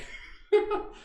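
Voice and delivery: high breathy giggle; little surprised giggle to yourself; airy giggle. Real, not acted. Amused at reversed camera.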